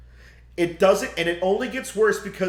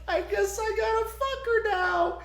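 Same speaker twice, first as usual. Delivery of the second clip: laughing through the quote. Retold or imitated. Retelling the film's sex scene.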